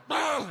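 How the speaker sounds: high loud startled bah; comic high-pitched yelp of shock; sudden yelp. Retold or imitated. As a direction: blurt it out sharp and high. Sudden outburst in the bed.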